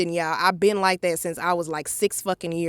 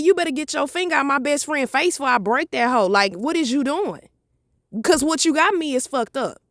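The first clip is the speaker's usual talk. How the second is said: higher, heated retelling of the threat; retold threat; 6 semitones higher than usual. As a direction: warn him off, fired up.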